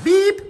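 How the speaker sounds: very high, loud "bip"; piercing spoken bleep; mock censor bleep. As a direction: imitate a censor beep over a swear word. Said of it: "La pute, ça sera coupé au montage".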